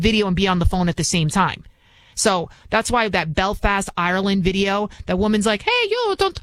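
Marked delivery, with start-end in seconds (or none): 5.6-6.4 high-pitched Irish accent attempt; a quick stab at an Irish woman. Host imitating an Irish bystander, Irish accent. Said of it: "I'm trying to do my Irish".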